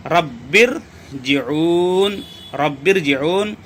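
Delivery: रब्बिरजिऊन very slow with a full, heavy r. Shown as a demonstration. Teacher reciting the example slowly for students. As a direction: stretch रब्बिरजिऊन, thick ra. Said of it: "बल्कि यहाँ पर क्या होगा".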